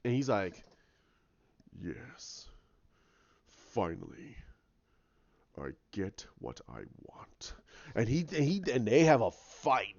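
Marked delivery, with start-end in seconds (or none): 1.8-7.5 very low, slow, hushed voice; gloating villain. Retold or imitated. Kylo finally getting his fight, low slow relish. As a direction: imitate a villain quietly savouring it.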